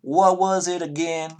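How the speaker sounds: reluctant Southern accent, hammed up for the table. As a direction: play it up as a friendly Southern stable hand. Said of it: "abhor the southern accent".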